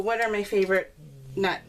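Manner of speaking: ordinary delivery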